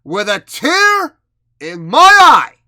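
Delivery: milk the line like a wrestler on the mic; Ric Flair. Retold or imitated. Quoting a wrestler to the audience, mock-emotional promo delivery. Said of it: "as Ric Flair would say".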